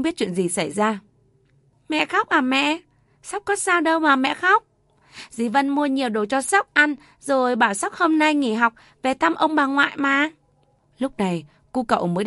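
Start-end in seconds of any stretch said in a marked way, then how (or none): 1.9-10.3 put-on much higher, innocent child voice; little boy. A narrator's reading. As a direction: chirp it like a small boy. Little boy Sóc talks to mother. (cậu bé nói với mẹ, giọng trẻ con cao)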